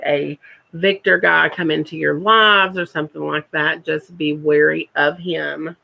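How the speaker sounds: normal talking voice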